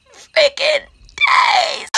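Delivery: slow, loud, teary outburst; crying shout. Real, not acted. Girl wails to viewers about her two-day exam. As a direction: wail it in exam stress.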